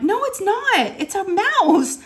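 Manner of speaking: high-pitched retold protest; frantic denial. Mother contradicting daughter about the mouse, frantic. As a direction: argue back in a high, panicky voice.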